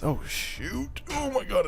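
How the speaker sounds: sudden high yelp of surprise; startled cry. Real, not acted. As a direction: caught off guard, shrieking up high. Crashes into red house.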